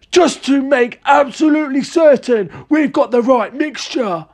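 excited crying out. A narrator's reading. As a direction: rant grandly in a high, overexcited voice. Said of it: "cried Mister Cranky".

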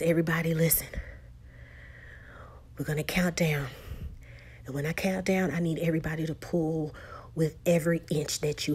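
soft unvoiced tense count; whispered countdown. Paul to everyone before the pull, whispered countdown.

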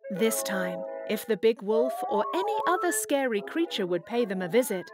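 ordinary delivery

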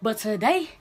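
normal talking voice

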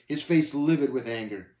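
ordinary delivery

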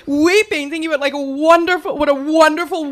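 ordinary delivery

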